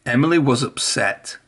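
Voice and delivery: plain reading voice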